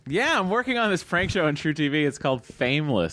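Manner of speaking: excited. Acted. Comedian acting out a script direction to plug his show, excitedly. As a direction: pitch it up with sudden enthusiasm. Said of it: "[name] excitedly tells us".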